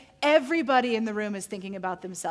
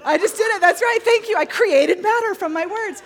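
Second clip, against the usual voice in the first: high, loud, laughing-crying boast; mock triumph; 9 semitones higher, 11 dB louder than usual. Joking she created matter from words, mock triumphant. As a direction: crow it at full voice, playfully.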